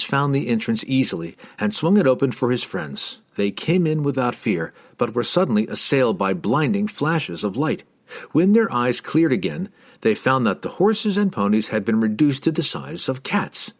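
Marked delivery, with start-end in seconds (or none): none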